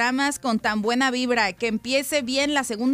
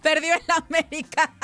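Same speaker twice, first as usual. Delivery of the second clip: telenovela villain. Real, not acted. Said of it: "tengo risa de villana de Disney".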